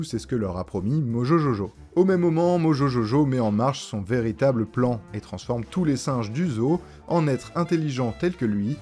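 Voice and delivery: usual speaking voice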